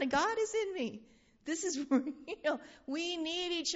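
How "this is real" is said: ordinary talk, nothing marked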